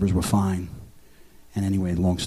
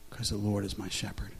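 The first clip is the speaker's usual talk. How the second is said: quiet faith; 8 dB quieter than usual. Real, not acted.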